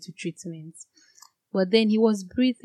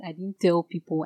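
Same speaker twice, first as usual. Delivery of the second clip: ordinary delivery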